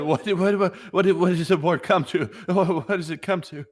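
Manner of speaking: lightly voiced stunned soldier; mock disbelief. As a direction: imitate a man in shock. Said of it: "he's in disbelief. He's, he's in shock".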